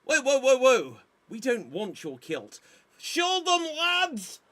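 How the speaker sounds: high, hammy mock Scottish; Braveheart king. Scottish king defying the English, hammed-up accent. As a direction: play the self-crowned Scottish king, over the top. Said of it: "So, I'm sounding Russian".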